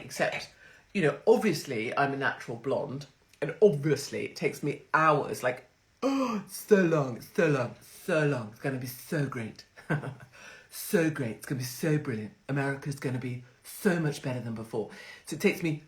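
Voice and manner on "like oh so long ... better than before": Trump impression. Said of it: "that's my Trump impression".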